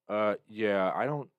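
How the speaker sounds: usual speaking voice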